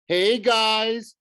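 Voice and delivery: high, loud cheerleader greeting; bubbly. A narrator's reading. Cheerleader greeting freshmen into the microphone, bubbly. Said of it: "bubbled in, uh, into the microphone".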